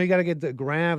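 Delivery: normal talking voice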